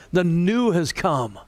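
usual speaking voice

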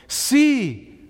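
high, loud one-word command; emphatic exclamation. Acted. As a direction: cry out the imperative. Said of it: "Exclamation point".

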